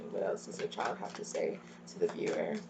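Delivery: soft, hushed question to the spirit; whispered aside. Real, not acted. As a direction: lean in and whisper it. Quiet aside asking the spirit.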